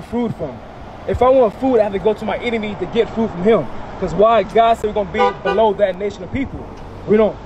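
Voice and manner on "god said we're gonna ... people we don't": ordinary talk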